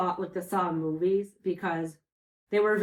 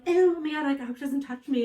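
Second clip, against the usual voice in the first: put-on grossed-out girls; 7 semitones higher than usual. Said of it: "they're like".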